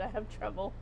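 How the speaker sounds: ordinary delivery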